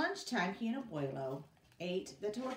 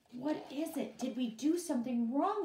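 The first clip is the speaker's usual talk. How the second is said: worried question; 8 semitones higher, 6 dB louder than usual. Acted.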